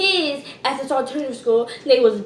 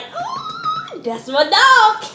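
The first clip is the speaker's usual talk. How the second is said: hyped reaction; 12 semitones higher, much slower than usual. Retold or imitated. Student reacting to what he said, hyped.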